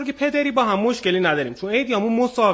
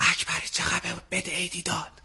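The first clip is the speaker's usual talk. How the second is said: quiet; 5 semitones lower, 11 dB quieter than usual. Retold or imitated. Father's aside quoted in story.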